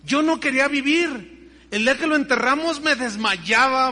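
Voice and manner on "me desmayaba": usual speaking voice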